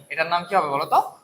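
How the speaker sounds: normal talking voice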